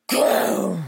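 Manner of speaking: loud snarling growl; a fierce, loud roar; angry tiger roar. A narrator's reading. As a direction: let the tiger roar outside the cave. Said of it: "brüllte es wütend".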